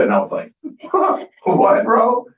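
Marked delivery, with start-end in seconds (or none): 0.6-1.4 a sudden high burst of laughter; startled laugh. Retold or imitated. Startled laugh at a coach's compliment on his skin. 1.4-2.3 high, louder startled "what, bro"; surprised. Retold or imitated. Caught off guard when the coach praises his skin, high surprised outburst.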